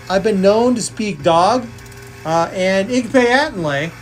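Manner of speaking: ordinary talk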